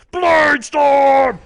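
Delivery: huge high drawn-out shout; hammed-up screamed attack call; boss battle cry. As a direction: roar the attack name like a game boss. Imitates boss's attack cry.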